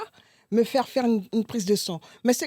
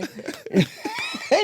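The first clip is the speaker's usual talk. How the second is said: high shrieking laugh. Real, not acted. Bursts out laughing mid-story.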